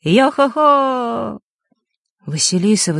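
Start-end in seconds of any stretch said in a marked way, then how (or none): 0.0-1.4 high, long-drawn call; stretched-out carrying cry, pitched up; loud holler. A narrator's reading. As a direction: holler as if through cupped hands. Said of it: "сложив ладони рупором, громко крикнул".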